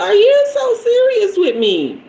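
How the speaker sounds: high, incredulous squeal; disbelief. Retold or imitated. Asking the clerk if he's serious. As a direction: stretch it out in disbelief.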